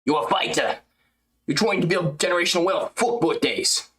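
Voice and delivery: lightly put-on fighter voice, mocking; impression of a fighter. Streamer imitating a fighter on stream, light put-on voice.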